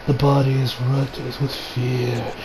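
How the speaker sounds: low, soft, slow villain voice; villain's English voice impression. New villain musing on fear, imitated English dub voice. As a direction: imitate a villain savouring fear, hushed and unhurried. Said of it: "I'm not doing a very good impression of it".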